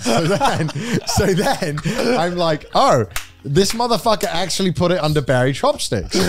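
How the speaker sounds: high, giggly words through laughter; laughing. Real, not acted. Telling friends the fake name worked, laughing. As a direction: still cracking up mid-story.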